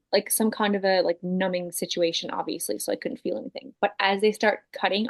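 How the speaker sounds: normal talking voice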